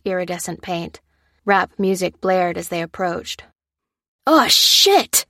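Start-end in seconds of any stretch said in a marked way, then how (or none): 4.2-5.2 sudden high shocked yell; startled cry. Acted. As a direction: cry out in alarm, high and loud. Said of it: "cried Priestley".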